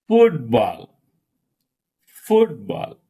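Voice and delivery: "Football" as FOOT-ball, falling off after FOOT; stress on first syllable. Shown as a demonstration. Teacher shows compound nouns, FOOT stressed. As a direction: punch FOOT in "Football", let ball drop. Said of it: "stress fall on first syllable".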